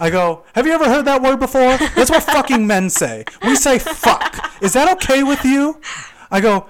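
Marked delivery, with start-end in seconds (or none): 0.5-5.8 high, loud challenge; angry retort. Retold or imitated. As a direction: throw it back in an acted-out argument, loud and high. Acting out his angry retort.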